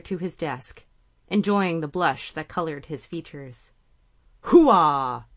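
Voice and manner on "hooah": loud barked Marine cheer; Marine shout. A narrator's reading. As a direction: bellow it like a Marine. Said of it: "shouted in a fair impression of a Marine".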